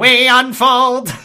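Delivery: loud, high, drawn-out robot proclamation; Dalek voice. Acted. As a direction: blare it like a comedy robot. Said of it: "has Dalek voice".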